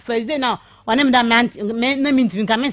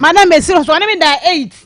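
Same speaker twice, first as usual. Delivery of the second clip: higher and louder, pressing the point hard; insistent exclaim; 5 semitones higher, 5 dB louder than usual. Real, not acted. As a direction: insist she be believed. Caller insists to doubting host.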